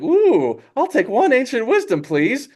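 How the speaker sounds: high, delighted request; eager buyer. Acted. Po to the scroll hawker, high and eager. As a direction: ask for it in a high, keen voice.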